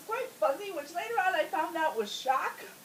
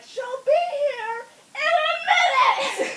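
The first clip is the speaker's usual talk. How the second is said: urgent holler; 10 semitones higher, 7 dB louder than usual. Retold or imitated. Woman in labor warning everyone, urgent holler. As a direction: cry it out loud and stretched, retelling.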